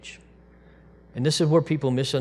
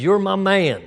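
excited declaration; 9 semitones higher, 9 dB louder than usual. Acted. Acted quote of believers' devotion.

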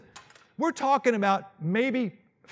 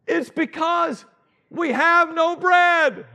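worried excuse; 9 semitones higher, 7 dB louder, much slower than usual. Acted. Disciples' worried excuse acted out.

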